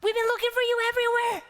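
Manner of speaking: high, rushed scolding from a frantic mother; mother scolding her runaway son. Acted. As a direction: scold the lost boy in a rush. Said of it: "She said".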